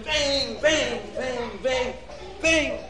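rapid string of high bangs; imitated bangs. A narrator's reading. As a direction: pound out high-pitched smash noises. Imitating repeated smashes.

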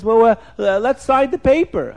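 acting out a flustered man calling her back; urgent mock protest. Retold man protests as she flees.

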